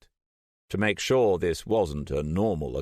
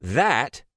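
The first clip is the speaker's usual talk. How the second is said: That leaned on, louder and higher; emphasized word; 8 semitones higher, 5 dB louder than usual. A narrator's reading. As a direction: punch the word That. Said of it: "emphasizing the word".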